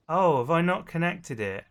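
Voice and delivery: ordinary talk, nothing marked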